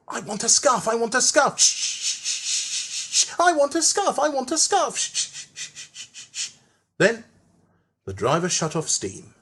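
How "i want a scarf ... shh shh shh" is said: higher engine chant with whispered shh puffs; Percy still puffing. A narrator's reading. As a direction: keep puffing the scarf chant as he works. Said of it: "still puffing".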